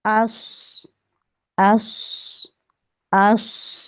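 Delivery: अस heavy with a whistle; thick whistling sound. Shown as a demonstration. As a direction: make अस thick and hissing. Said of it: "इसकी आवाज़ मोटी होगी और सीटी वाली होगी". (अस मोटी, सीटी वाली आवाज़)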